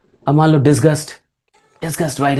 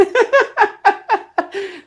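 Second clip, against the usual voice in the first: high burst of laughter; 12 semitones higher than usual. Real, not acted. Laughing at his own rant.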